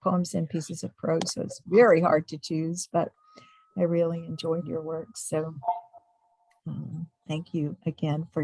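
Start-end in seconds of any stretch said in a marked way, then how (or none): none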